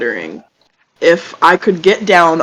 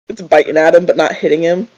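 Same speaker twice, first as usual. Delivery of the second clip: ordinary delivery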